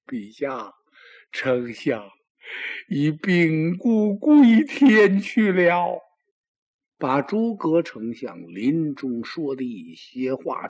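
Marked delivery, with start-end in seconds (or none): 0.1-6.0 tearful report. Acted. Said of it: "放声大哭".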